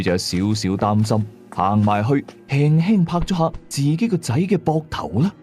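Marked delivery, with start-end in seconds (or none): none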